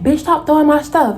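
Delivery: high-pitched mock-angry objection; indignant protest. Acted quarrel, man protesting.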